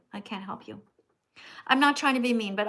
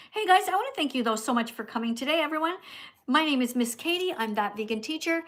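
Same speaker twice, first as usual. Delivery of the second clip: usual speaking voice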